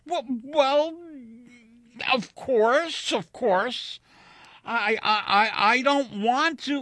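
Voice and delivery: high, loud, halting agreement; shaken stammering. A narrator's reading. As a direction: stammer out a flustered assent. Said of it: "Wilson was too shaken".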